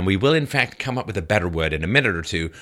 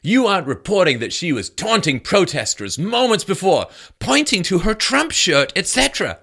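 mock tweet voice; 8 semitones higher than usual. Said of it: "Cicala tweeted".

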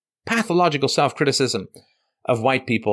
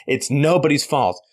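ordinary delivery

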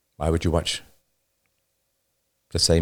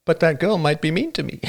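high-pitched mock whining; mock whiner; 10 semitones higher than usual. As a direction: play a timid complainer, pitched way up. Mocking an imagined whiner's objection.

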